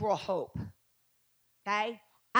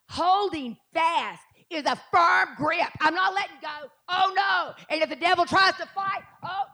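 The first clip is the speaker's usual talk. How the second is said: fiery preaching; 6 semitones higher than usual. Real, not acted. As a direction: preach it with fire. Preaching at full force.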